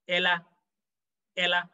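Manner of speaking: "elle a" run together as "e-la"; enchaînement. Shown as a demonstration. Teacher isolates the join so learners realize "la", chained "elle a". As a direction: slide the L of "elle" straight into "a". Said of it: "So you realize la".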